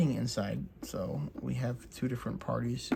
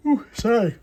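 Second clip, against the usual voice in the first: talking through a yawn; 10 semitones higher, 11 dB louder than usual. Real, not acted. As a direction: yawn mid-word, then apologize. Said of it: "I don't know why I yawned".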